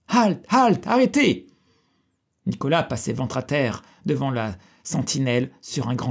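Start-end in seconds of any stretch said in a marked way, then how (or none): 0.1-1.5 high, loud sentry's call; shouting from afar. A narrator's reading. Guards crying out in the distance at a fugitive, urgent shout. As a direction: call out to halt a fleeing rider. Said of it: "nous entendîmes crier au loin".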